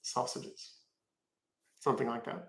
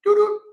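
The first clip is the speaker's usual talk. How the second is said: two sung notes, much higher and louder; Siri chime imitation; 7 dB louder than usual. Imitating the Siri chime for stream viewers, high sung two-note tone. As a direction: chime it like a phone assistant. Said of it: "She's the one that can do the Siri voice".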